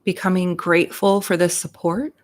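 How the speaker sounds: unhurried meditation voice with long pauses between phrases; guided meditation. Real, not acted. Reverend leading listeners into their hearts and breath, guided meditation. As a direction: guide them gently into breath and gratitude, leaving space after each phrase.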